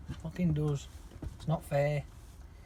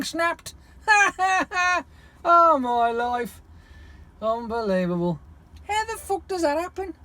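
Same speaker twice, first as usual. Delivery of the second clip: exasperated disbelief; 14 semitones higher, 6 dB louder than usual. Real, not acted. Laughing disbelief at bad luck.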